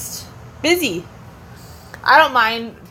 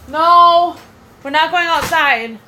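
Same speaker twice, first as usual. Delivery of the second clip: raised, higher voice laying down the rule; firm yell; 6 semitones higher, 5 dB louder, slower than usual. Real, not acted. Babysitter stopping toddler's escape outside, firm raised voice. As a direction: call out a firm refusal, not mean. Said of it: "if I'm yelling, I'm not yelling to be mean".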